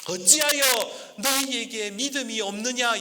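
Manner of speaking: high, slow solemn rebuke; drawn out, searching question; Jesus rebuking. Acted. Quote repeated as Jesus. (예수님의 반문, 높고 느리게)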